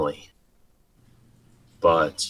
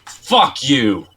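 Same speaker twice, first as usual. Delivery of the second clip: higher, louder curse; angry outburst; 7 semitones higher, 6 dB louder than usual. Acted. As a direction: throw it as you swing. Prisoner attacks.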